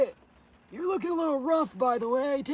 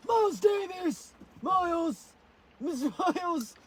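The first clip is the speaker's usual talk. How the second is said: calling out; 6 semitones higher, slower than usual. Real, not acted. Calling the dog.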